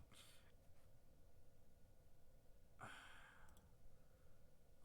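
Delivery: a long voiceless exhale of defeat; long defeated sigh. Real, not acted. After dying again, a long voiceless sigh of defeat. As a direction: let all the air out, fed up.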